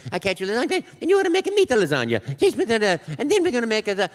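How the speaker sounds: high, loud excited cartoon plumber; Mario voice. Audition tape running on, loud high Mario. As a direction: rattle off pastas gleefully as Mario.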